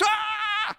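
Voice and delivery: short high shocked scream; a startled shriek from cold water; mock scream. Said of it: "she screams".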